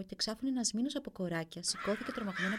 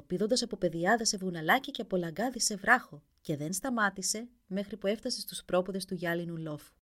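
plain reading voice

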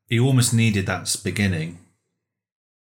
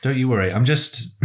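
ordinary talk

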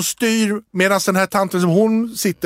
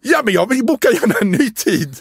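overcompensating eagerness; 7 semitones higher than usual. Retold or imitated. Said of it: "jag bara överkompenserar".

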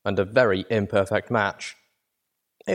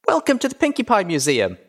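high-pitched gleeful filly host; bubbly little-girl announcement; bouncy excitement; 11 semitones higher, 3 dB louder than usual. A narrator's reading. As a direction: welcome them like a bouncing child. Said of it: "practically bouncing".

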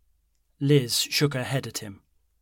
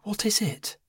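whisper; 10 semitones higher than usual. A narrator's reading. Worried granddaughter asks.

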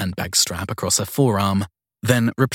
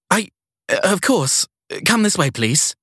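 high, slow nervous stammer; flustered young woman; 10 semitones higher, 3 dB louder, slower than usual. Acted. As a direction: caught off guard and eager to please. Sadie flustered by guest.

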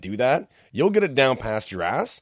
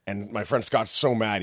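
ordinary talk

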